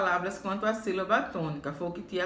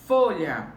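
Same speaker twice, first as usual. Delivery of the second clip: "Folha" with a loud, punched "Fo"; FO-lha, the "Fo" loud and the ending dropping; stress on first syllable; 2 semitones lower, 5 dB louder than usual. Shown as a demonstration. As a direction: hit the first syllable of "Folha" hard, then fall. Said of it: "sílaba mais forte fó".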